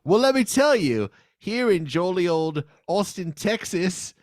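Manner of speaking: high, loud hammed-up British accent sliding toward Australian; mock British accent. Host keeps up the British bit for the segment, hammed-up British accent. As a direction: overdo the English accent until it drifts. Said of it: "it sounds Australian now".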